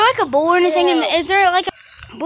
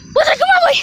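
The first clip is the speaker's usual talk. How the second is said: very high, loud summons; dog call; 12 semitones higher, 8 dB louder, faster than usual. Real, not acted. Owner calling the dog over, high loud call.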